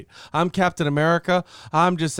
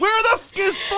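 much higher, fast shouted question; angry shout. Retold or imitated. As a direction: explode at the absent teammate. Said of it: "I was like".

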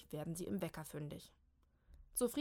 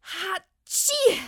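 high, very loud sneeze; big burst of a sneeze; 12 semitones higher, 15 dB louder than usual. Acted. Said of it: "muss Molly gerade niesen". (lautes Niesen)

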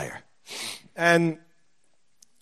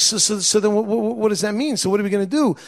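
higher, stammering retold question; flustered; 6 semitones higher than usual. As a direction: trip over the first words, flustered. Retelling his flustered reply to doctor.

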